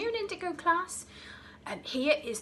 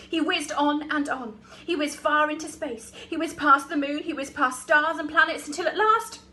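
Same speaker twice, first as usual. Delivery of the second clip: loud, higher, building narration; rising excitement; 4 semitones higher, 9 dB louder than usual. A narrator's reading. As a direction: launch it up like a rocket. Crocodile rocketing into space.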